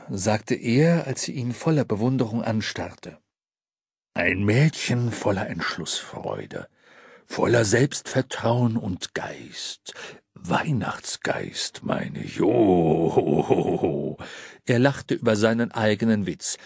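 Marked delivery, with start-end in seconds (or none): 4.1-14.2 low, slow, chuckling Santa; deep Santa voice. A narrator's reading. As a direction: praise her warmly, slowly. Santa praises girl, jokes.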